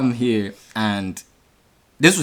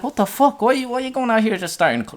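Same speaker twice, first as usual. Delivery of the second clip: high exasperated outburst; indignant, pitched up; 13 semitones higher than usual. Retold or imitated. Angry retold protest.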